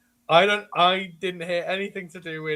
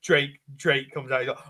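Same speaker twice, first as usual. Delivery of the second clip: normal talking voice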